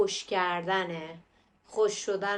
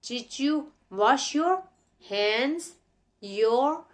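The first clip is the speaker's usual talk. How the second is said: Did you wash your said very slowly, linked "didju"; slow model line; 3 semitones higher, much slower than usual. Shown as a demonstration. Teacher modelling the cartoon line slowly for kids. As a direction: drag it out for learners.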